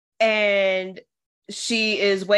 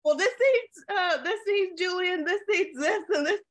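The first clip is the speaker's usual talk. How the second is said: high-pitched mock flustered orders; flustered cook; 10 semitones higher than usual. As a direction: rattle off kitchen orders in a raised, put-on voice. Acting out Molly's kitchen orders.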